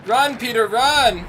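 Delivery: high, loud mock-panicked shout; urgent yell. Player urging Peter to run, high loud shouting. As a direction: yell at the hero to flee. Urging game character to flee.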